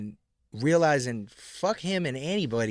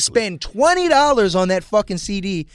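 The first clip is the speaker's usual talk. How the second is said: emphatic rant; 5 semitones higher, 5 dB louder than usual. Real, not acted. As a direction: hammer out each hardship. Emphatic rant about old days.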